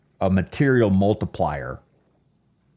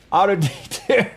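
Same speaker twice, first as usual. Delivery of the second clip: laughing; 10 semitones higher than usual. Pretend. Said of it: "Yeah, auto detune".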